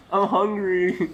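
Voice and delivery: laughing. Real, not acted. Caught eating friend's food.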